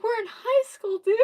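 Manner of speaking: high, loud disbelieving protest; retold exasperation. Exasperated retort in retold quarrel.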